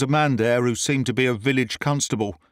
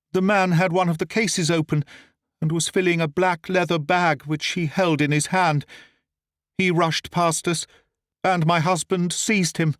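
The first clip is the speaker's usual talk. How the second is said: lady's false account; 6 semitones higher than usual. A narrator's reading. Lady gives false testimony.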